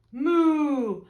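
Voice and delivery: loud, drawn-out moo; cow's moo. A narrator's reading. Cow answering the ladybird, loud moo. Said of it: "the cow said".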